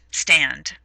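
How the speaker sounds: normal talking voice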